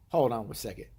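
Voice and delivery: normal talking voice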